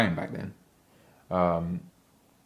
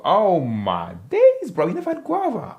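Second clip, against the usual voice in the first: high, stunned cry of disbelief; can't believe someone never had guava; shocked disbelief; 9 semitones higher, 4 dB louder than usual. Real, not acted. Streamer aghast that a viewer never tasted guava, high disbelief.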